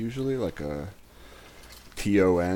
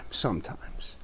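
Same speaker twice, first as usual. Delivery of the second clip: whisper; 7 dB quieter than usual. Real, not acted. Teasing whispered aside.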